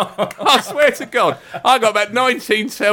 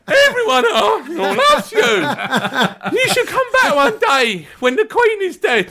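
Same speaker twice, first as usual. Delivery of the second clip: very high, strained gushing; hammed-up voiceless fan pleading with a hero; lost-voice impression; 13 semitones higher, slower than usual. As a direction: squeak out adoration with a ruined voice. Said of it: "I was talking like this all night".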